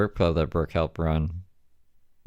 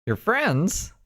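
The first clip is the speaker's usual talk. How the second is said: high mocking "friends?"; sarcastic; 14 semitones higher than usual. Real, not acted. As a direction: scoff at the word. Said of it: "that's some big words".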